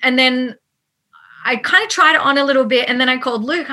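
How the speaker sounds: ordinary talk, nothing marked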